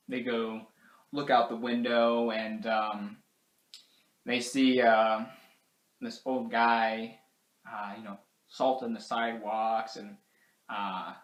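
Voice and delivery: plain reading voice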